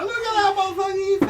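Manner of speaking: high, loud cackling mockery; Paco mocking. Paco jeering at the man who fell, high loud mock. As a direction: jeer at the fallen man, voice cracking high. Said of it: "and Paco's like".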